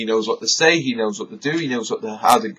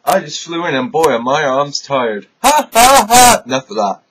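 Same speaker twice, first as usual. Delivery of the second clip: high, loud hammy comic host; old borscht belt comic; 3 semitones higher, 4 dB louder than usual. Acted. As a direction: ham it up like a cheesy comic. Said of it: "here's your host, Hippie Killer!".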